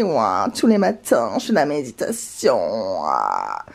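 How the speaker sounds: low, drawn-out spiritual guru; hammed-up zen lady; mock guru voice. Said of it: "je vais pas faire la fille".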